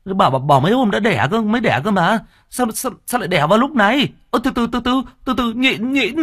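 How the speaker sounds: high, fast, flustered stammer; panicked husband. Acted. Husband panicking as his wife goes into labor, flustered high stammer. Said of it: "Người chồng giật mình".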